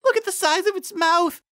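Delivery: high, loud, panicked cry; a frightened pony backing away, panicked. Acted. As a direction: keep the terror rising. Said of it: "Carrot Top exclaimed".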